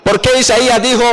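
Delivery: ordinary talk, nothing marked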